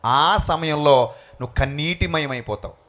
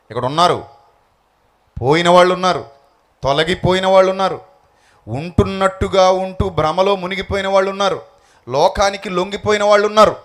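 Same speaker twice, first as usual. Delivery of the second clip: loud, hammering each వాళ్ళు ఉన్నారు; louder sermon roll, each clause ending in a fall; preaching at full force; 3 semitones higher, 6 dB louder than usual. Acted. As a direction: drive every line home to the congregation.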